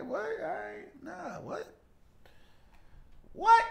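shocked what. Real, not acted. Reacts to shocking chat question.

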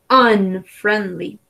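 "Unfriendly" slowly with the first vowel open like Spanish A; slow teaching model, U opened to an A. Shown as a demonstration. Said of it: "la pronunciación va como con una A".

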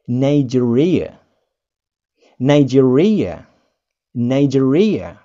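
Nigeria said nai-JEER-ee-uh slowly; English Nigeria. Shown as a demonstration. Teacher slowly models Nigeria for learners. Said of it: "Tolong diperhatikan".

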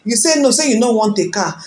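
normal talking voice

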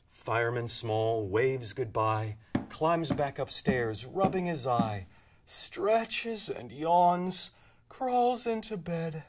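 soft, winding-down narration; softly read, fading out at the end; hushed bedtime ending. A narrator's reading. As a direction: lull the listener towards sleep. Story winds down to bedtime.

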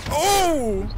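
high, loud startled oh; surprised shout. Real, not acted. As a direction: jolt of surprise. Sudden surprise in a firefight.

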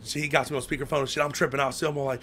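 ordinary delivery

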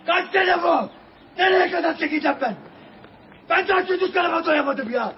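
high, loud, desperate protest; real outrage and despair; anguished angry shouting. Real, not acted. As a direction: shout it at the officers, close to breaking. Distraught man yelling while restrained.

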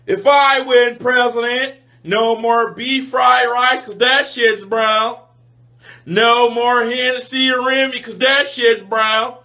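a hint of a candidate at the podium; lightly voiced politician making pledges; mock campaign speech.

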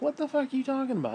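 retelling his outraged reply to police; indignant outburst. Retold or imitated. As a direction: fire it back in disbelief.

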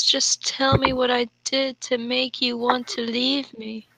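ordinary delivery

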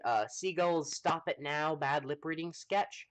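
usual speaking voice

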